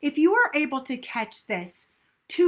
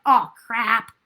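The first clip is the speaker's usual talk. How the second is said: louder, annoyed outburst at yourself; frustrated self-talk; 7 dB louder, slower than usual. Retold or imitated. Coach imitates negative self-talk after a slip, louder and annoyed. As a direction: blurt out the mistake in frustration. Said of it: "moving into negativity of".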